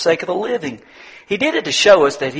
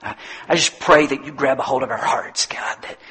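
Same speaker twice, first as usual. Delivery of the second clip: low, hushed pastoral prayer; solemn prayer; 3 semitones lower than usual. Real, not acted. Leads a closing prayer.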